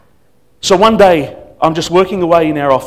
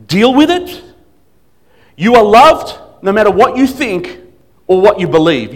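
raised, louder challenge with rising phrases; emphatic insistence; 4 semitones higher, 5 dB louder than usual. Retold or imitated. Pastor insisting to a runaway member that she is loved, emphatic. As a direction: drive it home like an exasperated parent. Retold fatherly scolding reaching its peak.

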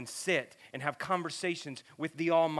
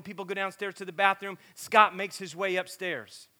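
normal talking voice

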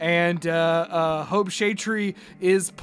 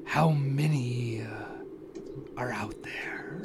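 whispering voice; 6 semitones lower, 8 dB quieter than usual. Acted. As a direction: breathe the question like a hidden stranger. GM voices whispering mystery figure.

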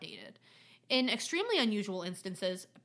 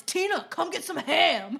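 slow, loud call to Tina; slow loud hammed-up yell to dinner; mock movie call; 4 semitones higher, 5 dB louder than usual. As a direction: call her in like the movie's grandma. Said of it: "No one says".

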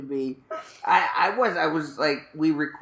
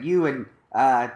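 normal talking voice

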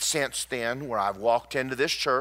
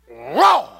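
sudden loud, high-pitched roar; mock lion roar; 5 semitones higher, 11 dB louder than usual. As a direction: roar like a lion for comic effect. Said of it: "I'm roaring like a lion".

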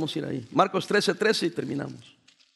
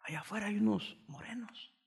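frightened whisper, barely any voice; a scared brother whispering a warning to the pastor; 3 semitones higher, 14 dB quieter than usual. Retold or imitated. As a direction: hiss out the warning, scared stiff. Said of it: "entra bien asustado".

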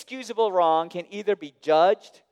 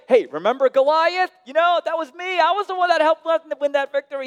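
high, fast, show-off voice; mock David boasting to God; bragging David; 12 semitones higher, 6 dB louder, faster than usual. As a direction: brag about old victories like a hammed-up hero. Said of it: "He doesn't say".

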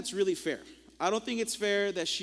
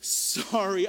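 sincere apology; much slower than usual. Retold or imitated. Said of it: "I felt so terrible".

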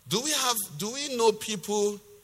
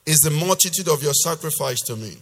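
loud, emphatic preacher's reading; forceful scripture reading; 3 semitones lower, 6 dB louder than usual. Acted. Preacher reading God's rebuke to the congregation, loud and emphatic. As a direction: declaim God's rebuke with hard-hit key words.

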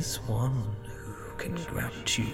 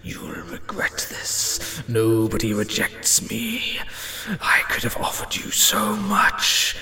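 slow, unvoiced threatening whisper; menacing whisper; 3 semitones lower than usual. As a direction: breathe the threat coldly. Entity threatens.

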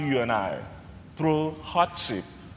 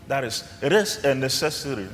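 ordinary talk

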